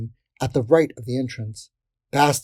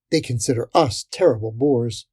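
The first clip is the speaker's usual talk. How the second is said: ordinary delivery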